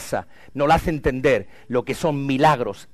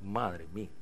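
stunned aside; 7 semitones lower, 15 dB quieter than usual. Retold or imitated. Pastor's stunned reaction.

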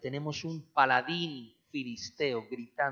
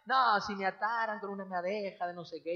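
imitated overconfident voice, higher pitched; cocky Samson; 5 semitones higher than usual. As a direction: play him breezily sure of himself. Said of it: "Y él siempre confiado". (Sansón confiado, imitado en tono agudo)

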